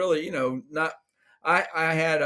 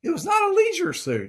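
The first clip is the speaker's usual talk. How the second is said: indignant protest; 6 dB louder than usual. Real, not acted. Husband protests wife's teasing.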